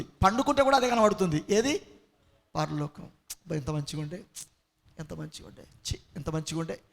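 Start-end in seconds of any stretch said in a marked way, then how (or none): 2.6-6.8 hushed, low murmur of regret; wistful devil. Acted. Devil remembers lost heaven, low and very soft regret. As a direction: sigh quietly over what was lost. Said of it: "వానికి ఎప్పటి కల్లో కూడా అదిగే".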